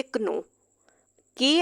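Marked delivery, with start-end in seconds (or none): 0.0-0.4 कनो with the k spoken aloud; wrong k sounded. Shown as a demonstration. Teacher shows the wrong कनो to avoid. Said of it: "ये नहीं कह सकते".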